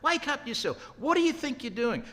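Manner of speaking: high-pitched, urgent rebuke; acted scolding, pitch jumping way up; forthright. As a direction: order him to pull himself together, pitched high. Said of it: "he's pretty forthright".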